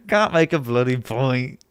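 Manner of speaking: slow mocking British accent, laughing into it. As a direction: parody the British chatter, slowly. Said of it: "Making fun of a British person's accent".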